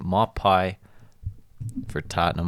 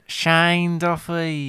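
put-on slow, drawn-out British accent, pitched higher; mock British accent; 9 semitones higher, much slower than usual. Saying the defender's name in a mock British accent. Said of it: "going for a little bit more of a British accent".

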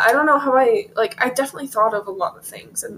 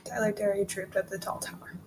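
quiet, careful repeat of "Tyler Terry trooped up the tall tower"; tongue twister speeding up; 2 semitones lower, 13 dB quieter than usual. Shown as a demonstration. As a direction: mumble the tongue twister back softly. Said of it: "You have to say it multiple times".

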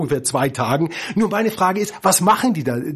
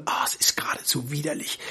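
disgusted whisper; 8 dB quieter, faster than usual. Real, not acted. Disgust at Christmas records.

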